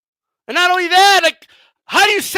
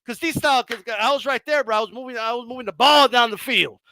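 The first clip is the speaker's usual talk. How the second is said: ordinary talk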